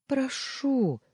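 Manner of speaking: high, whimpering plea; whimpered. A narrator's reading. Wife begging with folded palms, whimpering. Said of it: "проскулила она".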